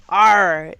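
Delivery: loud, stretched arr; pirate arr. Shown as a demonstration. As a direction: give a proper pirate arr. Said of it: "pirates commonly say".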